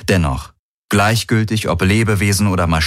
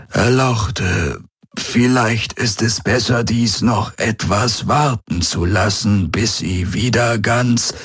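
put-on slow, higher old man's voice; elderly attendant; 4 semitones higher, slower than usual. A narrator's reading. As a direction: hesitantly object as a deferential old man. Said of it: "murmelte der greise Aur".

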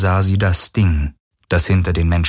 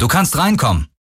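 raised pitch, calling someone in; 8 semitones higher than usual. A narrator's reading. Garland invites Kirai into the ship, calling out. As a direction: call over a distance without really shouting. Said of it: "rief Garland".

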